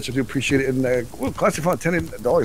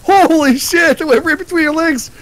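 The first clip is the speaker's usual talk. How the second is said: excited shout; 7 dB louder than usual. Real, not acted. Amazed by the arrow shot.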